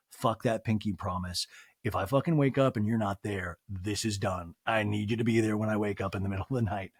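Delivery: quiet, deep, menacing demand; low, stern threat. Retold or imitated. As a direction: lay down the law, low and hard. Imagined clingy ultimatum.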